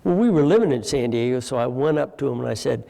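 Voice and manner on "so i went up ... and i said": usual speaking voice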